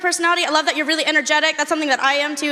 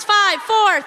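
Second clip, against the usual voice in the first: ordinary talk, nothing marked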